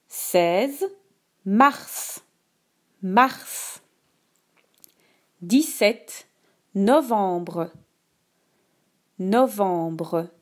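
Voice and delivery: slow, clear dictation voice; dictating. Shown as a demonstration. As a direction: read each word slowly for dictation. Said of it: "Écoute attentivement la dictée".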